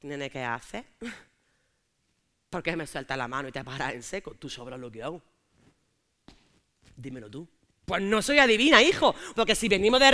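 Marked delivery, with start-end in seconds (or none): 0.0-7.5 suéltalo cortante y bajito, conteniendo el enfado; novio seco. Acted. Novio enfadado, tono bajo.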